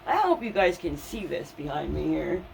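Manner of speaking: ordinary talk, nothing marked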